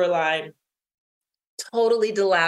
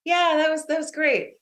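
higher, unconvincing agreement; feigned enthusiasm; 8 semitones higher than usual. Retold or imitated. Downcast swimmer answering cheerful group, feigned enthusiasm. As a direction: play along with mock enthusiasm.

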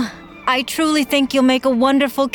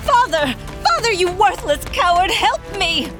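frightened cry; 12 semitones higher than usual. Acted. Ariadne carried off, calls out.

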